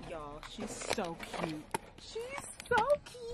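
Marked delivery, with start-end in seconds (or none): 2.0-3.4 much higher, slow squealing delight; excited squeal. Real, not acted. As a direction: squeal it out with joy. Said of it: "I'm screaming".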